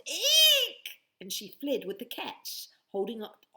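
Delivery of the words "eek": very high, loud eek; shrill eek. Acted. Betty fleeing the goo, loud high squeal. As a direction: squeal in panic. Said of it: "Betty yelled".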